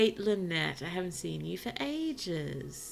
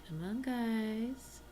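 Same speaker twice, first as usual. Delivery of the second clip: "Come on, guys" drawn out slowly, higher, rising at the end; coaxing; 5 semitones higher, much slower than usual. Real, not acted. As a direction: coax them along, stretched and sing-song. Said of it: "Am I being encouraging".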